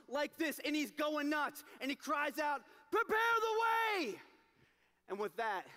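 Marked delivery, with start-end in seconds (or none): 2.9-4.2 high, slow herald's shout; a messenger bellowing down the hill; crying out. Acted. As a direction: call it out loud to the whole town.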